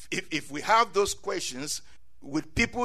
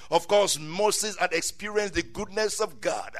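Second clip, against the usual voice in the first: ordinary talk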